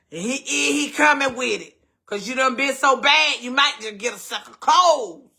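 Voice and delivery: loud playful teasing. Retold or imitated. Mother teasing her kids about Santa, loud and playful. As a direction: tease them loudly that Santa won't come.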